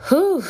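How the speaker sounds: high loud exhale after a rant; relieved whew. Real, not acted. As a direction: blow out the tension. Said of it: "that's enough of that rant".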